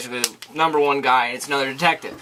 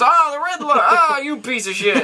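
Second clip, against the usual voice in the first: high, jeering sneer breaking into a laugh; hammed-up mocking cop voice; mocking detective; 11 semitones higher than usual. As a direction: sneer at the poem while laughing. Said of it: "he reads and he goes".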